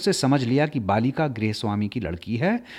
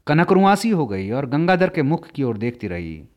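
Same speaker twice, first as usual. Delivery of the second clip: ordinary delivery, nothing marked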